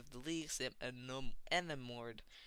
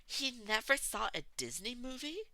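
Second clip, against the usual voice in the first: high, loud shocked disbelief; shocked gasp; 11 semitones higher, 4 dB louder than usual. A narrator's reading. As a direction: gasp at it, outraged for the kid. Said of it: "Magne gasps".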